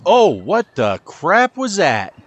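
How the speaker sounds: slow, stunned disbelief at the shot; what on earth was that; shocked outburst. Real, not acted. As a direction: drag it out, can't believe it. Bad golf shot surprises him.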